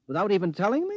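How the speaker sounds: shocked. Acted. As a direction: caught off guard by his wife's secret. Husband learns of secret withdrawal.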